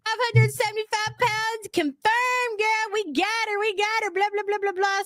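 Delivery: high mocking sing-song; mocking haters voice. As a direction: taunt like a gloating hater. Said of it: "proving everybody correct".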